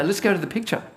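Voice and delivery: usual speaking voice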